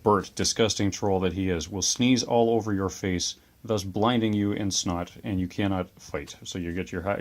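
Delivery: ordinary talk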